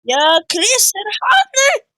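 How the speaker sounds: pleading shout. Real, not acted. Gamer yelling at his player, a high begging shout.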